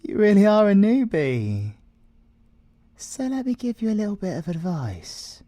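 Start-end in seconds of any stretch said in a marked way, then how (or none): 0.0-1.7 louder and higher, mocking the newbie; mocking tease. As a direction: rub it in, loud and amused. Mocking the rookie inspector.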